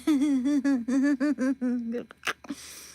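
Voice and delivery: high, rolling giggle; real laughter that keeps going; long amused laugh. Real, not acted. Laughs after mimicking tricked guys.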